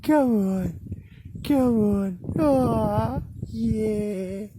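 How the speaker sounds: high sing-song coaxing call; high pet voice. Real, not acted. Walking her cat, calls it in a high pet voice.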